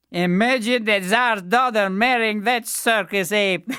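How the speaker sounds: high, loud jeering voice of the brothers; mocking brothers. A narrator's reading. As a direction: sneer at the fool from above.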